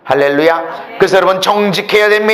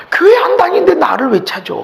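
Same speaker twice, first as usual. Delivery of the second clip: high, whiny complaint as the friend; playing the friend, higher and crying; mock whine; 9 semitones higher, 4 dB louder than usual. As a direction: whine it like a put-upon friend. Retold friend's whining reply.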